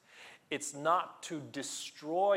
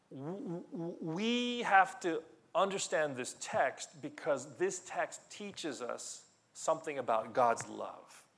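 ordinary talk, nothing marked

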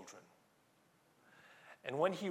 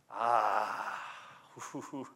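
getting excited; 4 semitones lower than usual. Real, not acted. Preacher moved by understanding God's love, getting excited. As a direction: overcome sigh breaking into a laugh. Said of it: "getting excited".

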